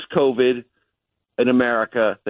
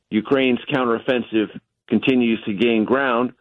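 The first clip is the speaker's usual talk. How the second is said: normal talking voice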